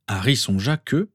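ordinary delivery

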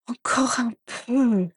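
slow, groggy mumbling; sleepy mumble. Acted. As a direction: mumble it half asleep. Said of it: "marmonna Andromeda, la voix pâteuse".

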